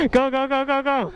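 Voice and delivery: high excited chant; excited cheering. Real, not acted. Cheering the car on.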